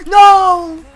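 shouting no in dismay; loud shout. Real, not acted. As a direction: yell it out in despair. Threw pearl, dying.

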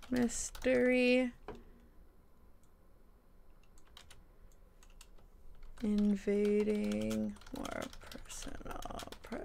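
very slow, stretched-out words, softly; dictating while typing. Real, not acted. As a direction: sound out each word slowly as you type it.

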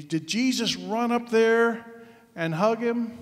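ordinary talk

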